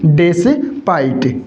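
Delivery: Despite split into "des" and "pite"; teacher's drawn-out, two-part despite; slow sounding-out. Shown as a demonstration. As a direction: break despite slowly in two. Teacher sounds word out in parts.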